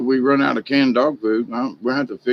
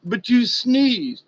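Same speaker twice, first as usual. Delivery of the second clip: accuser voiced; 8 semitones higher, much slower than usual. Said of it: "somebody said".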